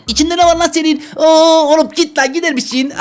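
very high, panicky recoil; high-pitched playful fright; mock alarm. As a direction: shoo it away in a high, alarmed voice. Recoils at worms inside the eggplant.

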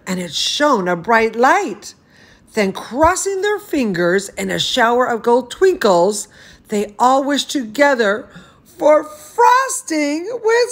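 lively storybook reading voice; animated picture-book narration; reading to children. Acted. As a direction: read it like bedtime story time for a toddler. Reads picture book aloud.

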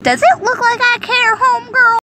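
high, loud, sassy brush-off; sassy retort. Acted. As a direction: toss it off with attitude. Character snaps back at teammate.

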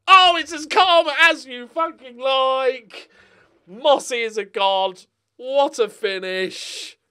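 loud, high and slow, savouring the goal; ecstatic celebration. Real, not acted. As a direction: cheer it out like the winner just went in. Celebrating late winning goal.

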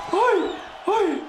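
very high yelp of alarm; startled cry. Real, not acted. Streamer gets hit in the fight, very high startled yelp. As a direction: yelp as the punch lands.

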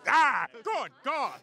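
high, theatrical outcry; loud alarm. Acted. Diner finds a cockroach in the salad, alarmed. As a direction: cry out high in showy horror.

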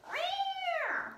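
high, strained cat meow; angry cat meow. Retold or imitated. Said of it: "they go".

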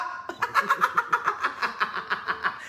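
high, soft giggling fit; long laugh. Real, not acted. Seller cracks up at the absurd total, long high laugh. Amused by the sum of money.

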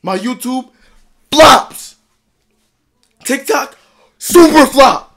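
raw, desperate shouting rant; anguished yelling. Real, not acted. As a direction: scream out the frustration. Distraught rant about failing channels.

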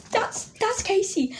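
high, drawn-out excited exclamation; excited recognition. Retold or imitated. Vlogger retells recognising [name] as [name], excited. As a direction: blurt it high, like spotting a star.

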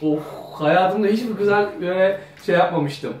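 ordinary talk, nothing marked

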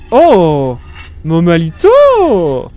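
loud drawn-out call; excited shout. Real, not acted. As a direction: shout the name slowly. Excited discovery exclamation.

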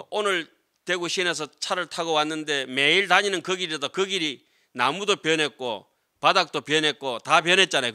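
usual speaking voice

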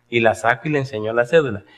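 ordinary delivery

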